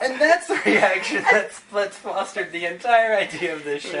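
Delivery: laughing. Real, not acted. Laughing while answering.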